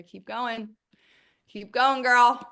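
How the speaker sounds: loud, high cheering; excited cheer. Real, not acted. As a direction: cheer her on loudly. Cheering on a listener.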